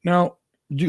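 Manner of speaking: usual speaking voice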